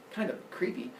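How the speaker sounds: usual speaking voice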